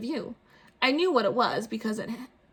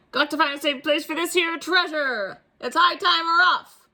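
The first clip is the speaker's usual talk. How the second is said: high, louder captain's call; captain ordering the crew off; pirate announcement; 6 semitones higher, 5 dB louder than usual. Acted. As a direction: announce the departure. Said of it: "he announced".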